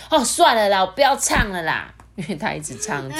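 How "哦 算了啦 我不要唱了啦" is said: louder whiny giving up; fed-up princess. Acted. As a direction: quit in a huff. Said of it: "艾莉诺就说".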